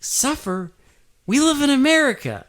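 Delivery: hammed-up outraged American; mock indignant American. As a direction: squeal it like a spoiled brat. Character's mock indignant reply.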